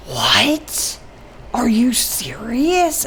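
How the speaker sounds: shocked whisper, rising question; incredulous, drawn-out retold whisper; whispered disbelief. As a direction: act out whispering in alarm. Shocked reply during hushed exchange.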